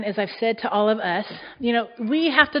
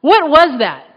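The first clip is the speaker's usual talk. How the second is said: louder, higher stunned outburst; amazed exclamation; 4 semitones higher, 7 dB louder, slower than usual. Retold or imitated. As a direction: exclaim it like you just saw a miracle. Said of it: "you were like".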